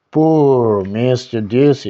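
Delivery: ordinary delivery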